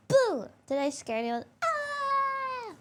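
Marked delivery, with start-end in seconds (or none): 0.1-0.5 sudden high loud boo; jump-scare shout of boo; startling boo. Pretend. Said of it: "Yes, you scared me". 1.6-2.7 mock scream. Mock fright after the boo.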